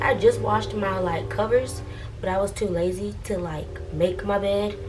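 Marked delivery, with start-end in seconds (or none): none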